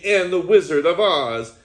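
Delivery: ordinary delivery, nothing marked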